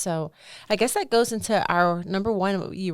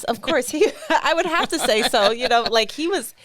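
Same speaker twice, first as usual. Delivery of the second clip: laughing; 6 semitones higher, 7 dB louder than usual. Real, not acted. Laughing reply about Jesus.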